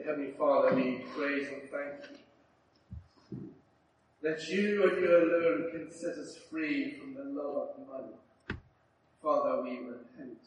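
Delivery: low, earnest public prayer. Real, not acted. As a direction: lead a congregation in solemn repentance. Leads closing prayer.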